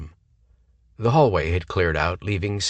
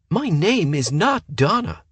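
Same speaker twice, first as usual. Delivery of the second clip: 1 indignant airhead; 11 semitones higher, 4 dB louder than usual. A narrator's reading. Ditzy woman retorts indignantly.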